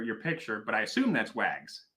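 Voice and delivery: normal talking voice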